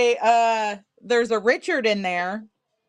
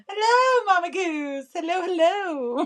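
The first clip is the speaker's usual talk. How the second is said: much higher, cheerful hello; bright sing-song greeting; 8 semitones higher than usual. Real, not acted. Welcoming Mama Goose as she joins the live, bright sing-song greeting. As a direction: call out a delighted welcome.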